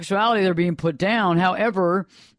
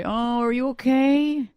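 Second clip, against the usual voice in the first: high, slow, drawn-out mock concern; cooing sympathy; 7 semitones higher, slower than usual. Therapist voicing the pity clients look for, cooing. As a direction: coo it like pitying a fragile client. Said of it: "they're looking for me to say".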